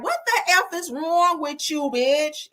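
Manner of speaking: high-pitched mock New York accent; light New York imitation, indignant. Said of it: "In my New York accent".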